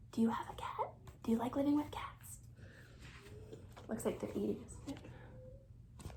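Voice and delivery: put-on soft whisper to the child; a secret whispered question; whispered aside.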